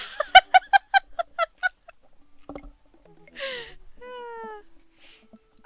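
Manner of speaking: high, loud uncontrolled laughing; loud burst of laughter. Real, not acted. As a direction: howl with laughter. Big laugh after shock.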